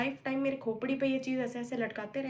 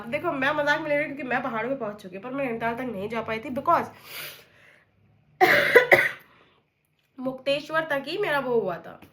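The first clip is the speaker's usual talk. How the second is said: ordinary delivery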